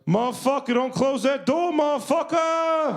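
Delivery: very high mock yell, last word stretched; alarmed yell. Host warns performer about broken door, alarmed yelling. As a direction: yell a frantic warning upstairs.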